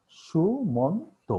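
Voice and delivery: সুমন্ত split slowly into three beats, su-mon-to; syllable by syllable. Shown as a demonstration. Teacher splitting সুমন্ত into three syllables for students. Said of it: "এই শব্দটাকে আমরা তিনবারে উচ্চারণ করছি".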